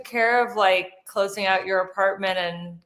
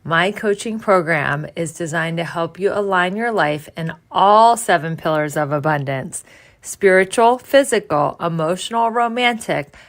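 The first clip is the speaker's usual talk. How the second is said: punchy, louder promo voice selling coaching; upbeat ad read; 3 semitones lower, 6 dB louder than usual. Acted. [name] pitching her coaching program to listeners, energetic ad read. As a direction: sell the program with bright energy.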